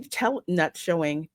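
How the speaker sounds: usual speaking voice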